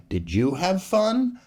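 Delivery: usual speaking voice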